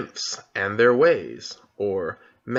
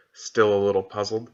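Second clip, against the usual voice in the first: ordinary delivery, nothing marked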